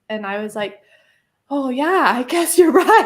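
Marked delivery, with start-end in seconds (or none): 1.5-3.1 high, loud laughing oh yeah; laughing admission. Retold or imitated. Admitting to Pippa she was right, laughing high and loud. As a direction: giggle it out, caught out.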